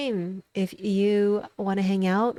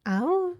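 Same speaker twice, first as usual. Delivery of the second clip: short high howl; quick playful "awoo"; playful wolf howl; 9 semitones higher than usual. Pretend. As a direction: give a little high wolf call. Wolf howl welcoming a follower.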